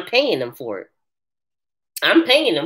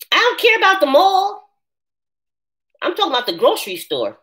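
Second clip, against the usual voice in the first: emphatic retort; 10 semitones higher than usual. Real, not acted. Arguing with viewer.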